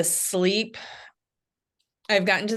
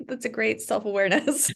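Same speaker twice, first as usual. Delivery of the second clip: amused, higher voice with laughter; laughing; 4 semitones higher than usual. Real, not acted. Woman reacts to a colleague's confession about forgetting to breathe, laughing. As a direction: laugh through the words.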